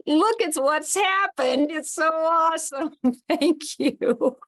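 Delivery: high, slow, amazed and moved; delighted awe. Real, not acted. Leader thanking the group, overwhelmed with joy. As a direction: gasp it out in happy wonder.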